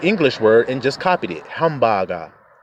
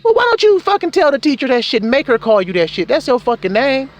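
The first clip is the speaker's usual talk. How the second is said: much higher, louder disbelief; incredulous outburst; 12 semitones higher, 4 dB louder than usual. Retold or imitated. Schoolboy urging friend to use his real name, incredulous. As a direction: blurt it out, can't believe it.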